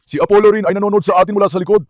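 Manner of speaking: usual speaking voice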